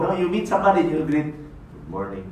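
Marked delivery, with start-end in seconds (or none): 1.4-2.2 very low, very soft mumble; unenthusiastic greeting. Shown as a demonstration. You meet somebody and greet them, listless. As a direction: greet with no energy, dropped low and quiet.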